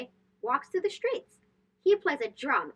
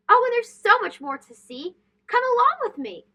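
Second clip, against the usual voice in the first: bright, higher sing-song call to come along; excited invitation; 8 semitones higher, 6 dB louder than usual. A narrator's reading. Narrator calling young listeners onward, bright and excited. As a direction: lift it up like beckoning a child to follow.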